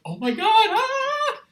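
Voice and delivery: very high, loud excited shriek; fan squeal. Retold or imitated. Fan shrieking inside after a star praises his hat. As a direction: squeal like a starstruck kid.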